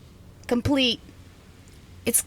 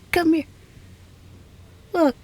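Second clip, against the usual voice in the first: firmer and a bit louder, meaning it; insistent; 7 semitones higher, 4 dB louder than usual. Acted. Scientist insisting her guest come look at the lab, firm call. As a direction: call them over again, this time insisting. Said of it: "No, I mean it".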